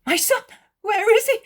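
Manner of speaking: very high, loud cry of fright; almost shout it, panicked and high; frightened near-shout. A narrator's reading. Mother asks Jesus after her missing son, almost shouting. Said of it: "She almost shouts, asking".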